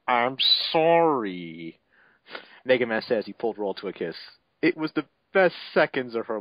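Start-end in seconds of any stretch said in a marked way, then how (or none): none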